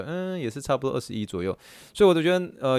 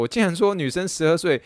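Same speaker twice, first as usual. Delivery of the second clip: usual speaking voice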